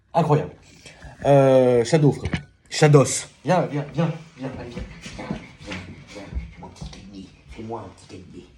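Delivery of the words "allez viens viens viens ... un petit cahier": whisper. Real, not acted. Soft words to dog.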